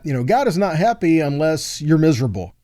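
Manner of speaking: ordinary talk, nothing marked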